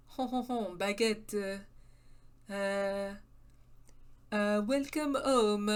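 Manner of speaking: lightly hammed French accent drifting off; mock French accent. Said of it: "It's slipping Italian again".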